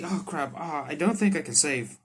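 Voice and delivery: normal talking voice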